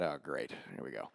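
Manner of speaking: low, very quiet sarcastic aside; under-the-breath, deflated irony; resigned dread. Retold or imitated. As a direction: sink into a muttered here-comes-trouble. His inward dread at her dream.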